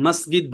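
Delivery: মসজিদ as "masgid", hard g; hard g in place of j in মসজিদ; Egyptian pronunciation. Shown as a demonstration. Teacher repeating the Egyptian form, মসজিদ said "masgid". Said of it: "মিশরে".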